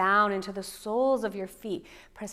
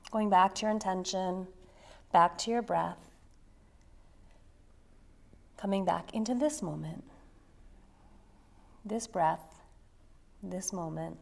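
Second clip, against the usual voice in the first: guided meditation; 5 dB quieter than usual. Acted. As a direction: draw them gently into the moment. Centering meditation cues.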